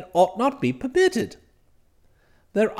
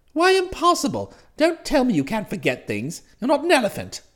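agitated protest; 3 semitones higher than usual. Acted. Master argues with valet who refused him, agitated. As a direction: challenge the refusal indignantly.

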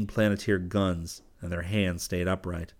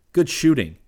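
raised, pleased call; 7 semitones higher, 6 dB louder than usual. A narrator's reading. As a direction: call the praise across to him. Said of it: "Rip called".

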